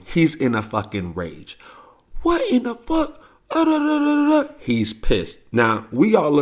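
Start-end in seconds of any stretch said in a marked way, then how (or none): 2.2-4.5 mock enraged uncle. Uncle raging at ruined pictures.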